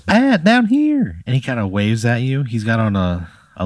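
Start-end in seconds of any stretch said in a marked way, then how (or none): 0.0-1.2 squeaky high cartoon pickle calling out; little pickle shouting up from the plate; tiny pickle voice. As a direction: call up from below in a tiny squeaky voice. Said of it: "And he goes".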